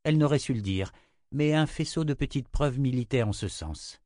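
ordinary delivery, nothing marked

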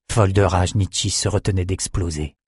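usual speaking voice

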